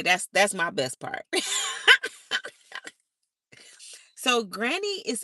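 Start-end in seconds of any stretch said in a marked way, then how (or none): 1.3-2.5 loud high-pitched laugh; high burst of laughter. Real, not acted. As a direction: burst out laughing at your own line. Laughing at own joke.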